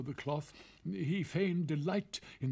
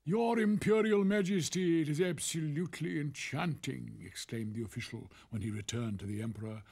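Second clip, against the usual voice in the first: pompous fussy courtier; 2 semitones higher than usual. Acted. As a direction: fawn over the emperor grandly. Official gushes to the emperor.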